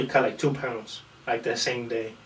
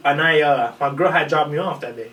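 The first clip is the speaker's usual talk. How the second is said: normal talking voice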